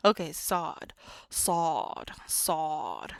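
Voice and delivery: "sod" with a heavy emphatic S and a dark vowel; dramatic sod. Shown as a demonstration. Teacher demonstrating the emphatic S, loud. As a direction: say "sod" loud, from the back of the mouth. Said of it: "it's a dramatic S".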